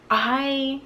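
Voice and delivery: usual speaking voice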